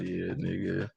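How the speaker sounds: soft, muttered comment; quiet mock reply; muttered aside. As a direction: say it under your breath. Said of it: "I'm like".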